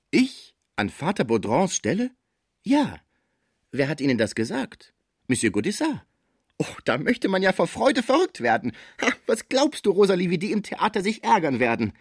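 high, excited, incredulous; astonished joy. A narrator's reading. Father learns of his new post, overjoyed disbelief. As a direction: start stunned, then burst out high with glee.

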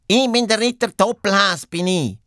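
high-pitched boastful little hero; proclaiming hare knight. Acted. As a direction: announce yourself as the knightly hare. Hare proclaims himself to princess. (hohe, stolze Hasenritter-Stimme)

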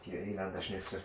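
low, soft grumble under the breath; muttered curse. Acted. Doctor cursing the snooping clinic under his breath, muttered. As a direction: curse them quietly to yourself.

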